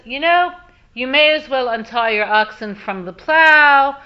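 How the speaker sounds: loud storyteller's voice for a passer-by; acted calling out. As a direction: call it out firmly to a farmer.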